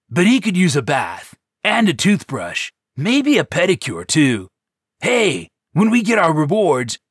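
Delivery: high, loud, carefree joker; bouncy, laid-back goofball; goofy cheerful voice. Acted. Cool's cheerful chatter.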